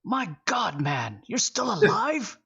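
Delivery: lightly voiced old miner, surprised and glad; a touch of a delighted old man.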